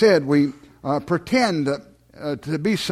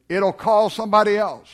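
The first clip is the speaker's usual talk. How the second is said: normal talking voice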